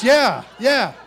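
put-on high, loud eager old man; dad's voice. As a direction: play dad, eager. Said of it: "He goes".

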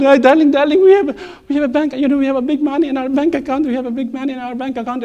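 high, loud rush of good news, speeding up; wife bursting with news of money in the bank, high and fast; excited wife. Retold or imitated. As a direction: blurt out the windfall in a breathless rush. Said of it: "She was shaking".

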